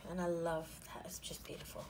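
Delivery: unvoiced hushed admiration; whisper. Real, not acted. Vlogger admiring washi tape to viewers, whispering. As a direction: breathe it out like a secret.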